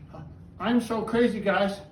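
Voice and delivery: ordinary talk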